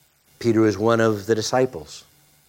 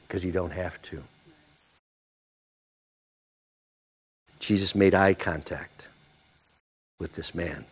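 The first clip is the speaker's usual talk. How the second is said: low, soft solemn telling; hushed sermon; 5 semitones lower, 5 dB quieter than usual. Acted. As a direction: draw them in quietly to the moment.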